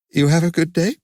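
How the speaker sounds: high, forced brightness; a mother brushing her tears aside; mother's voice. A narrator's reading. Mother changes subject with her son, brightly forced.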